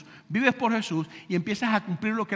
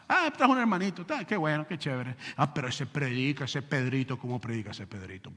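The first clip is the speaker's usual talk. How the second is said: soft, lower gossiping voice; gossipy churchgoer; 8 semitones lower, 5 dB quieter than usual. Retold or imitated. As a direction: whisper it like a fawning church member. (chismoso de iglesia, voz baja y burlona)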